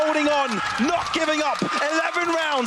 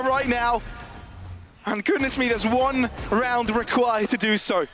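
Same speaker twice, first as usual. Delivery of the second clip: ordinary talk, nothing marked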